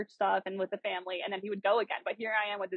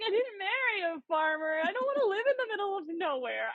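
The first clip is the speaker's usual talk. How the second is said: high, indignant protest; 10 semitones higher than usual. Retold or imitated. Wife refusing the farm to husband, high indignant. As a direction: play the reluctant wife digging in. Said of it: "I was like".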